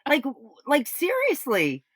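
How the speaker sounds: lightly voiced shocked daughter; stunned disbelief. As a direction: act out being floored by the calm reaction. Retold shock at dad's reaction.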